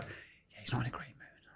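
hushed warning whisper. Retold or imitated. Press officer whispering a warning to the reporter. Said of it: "She was like".

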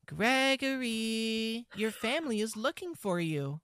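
high, drawn-out character call; high, feminized game character voice; Chica. Acted. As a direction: stretch the name, high and lilting. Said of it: "now do the Chica line".

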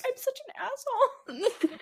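high, drawn-out wail through laughter; laughing self-reproach. Retold or imitated. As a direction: own up guiltily, half laughing. Laughing at own guilt.

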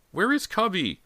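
very high, urgent question; crying out. A narrator's reading. Boy desperate to find his puppy, crying out. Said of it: "James cried".

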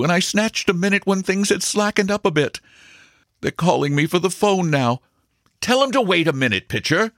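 high and rushed, pleading; eager, distracted lover short on time; hurried, restive broker. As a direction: broker pleading with the stenographer while the phone calls, high and rushed. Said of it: "said Maxwell restively".

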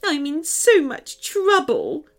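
high, halting, weepy little voice; tearful. Acted. Small girl tells strangers she is in trouble, tearful. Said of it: "a tear-covered face".

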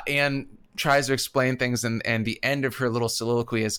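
ordinary delivery

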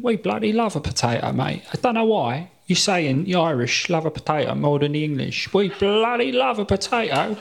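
imitating a high English bloke, mock indignant; English accent. Comedian mocking English audience members, in a high mock English accent. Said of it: "English people have come to me after shows and said".

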